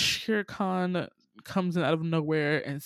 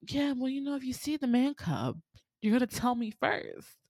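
higher, pushy threat; threatening tiger; 7 semitones higher than usual. Retold or imitated. Tiger choking the snake, pushy and higher. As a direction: lean on him while choking him. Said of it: "And it's just like".

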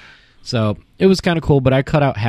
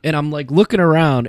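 usual speaking voice

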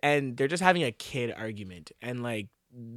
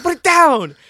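high and loud cut it out; hammed-up furious older brother; imitating a yelling brother; mock angry shout; 15 dB louder than usual.

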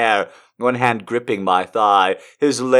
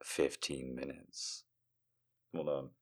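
ear whisper; 10 semitones lower, 25 dB quieter than usual. Acted. Man at the bar leaning to her ear, whispering low. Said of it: "whispers in my ear".

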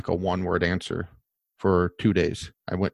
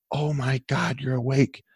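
mock excited gasp; 5 semitones higher, 4 dB quieter than usual. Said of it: "she just, like, gets super excited".

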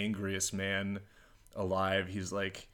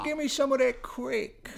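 mock Baltimore accent; 3 dB louder than usual. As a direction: badly fake a Baltimore accent, squeaked high. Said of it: "how to do a Baltimore accent".

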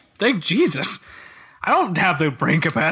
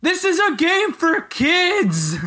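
high, slow disbelieving protest; exasperated; 10 semitones higher, slower than usual. Real, not acted. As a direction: stretch it out in disbelief. Exasperated repeat of complaint.

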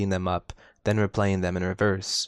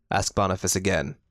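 plain reading voice

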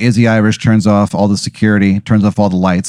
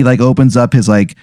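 ordinary talk

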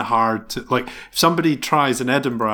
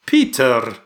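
lightly imitated bad Scottish accent, louder on the name; 5 dB louder than usual. Guessing for the host how the actor says it, bad Scottish accent. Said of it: "but he probably goes".

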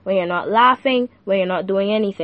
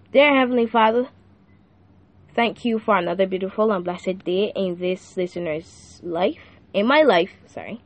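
quiet prayer; 4 semitones lower, 3 dB quieter than usual. Acted. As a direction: lead the listener in a hushed prayer. Leads the sinner's prayer.

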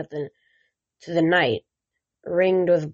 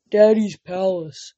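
a big simple brother cheering you awake; childlike Cyclops brother. Acted. Tyson the Cyclops speaks.

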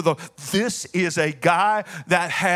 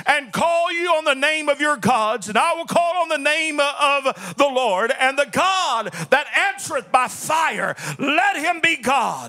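raised, driving preacher's voice building to a shout; full-force preaching; 5 semitones higher than usual. Acted. Preacher hyping the Super Bowl of gods to his congregation, full-force preaching. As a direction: preach it out to the congregation like a showdown announcement.

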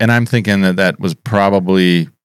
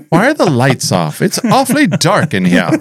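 high, prissy complaining voice; fussy old man voice; 10 semitones higher than usual. Pretend. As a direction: whine like a fussy old gent. Mock fussy complaint about darkness.

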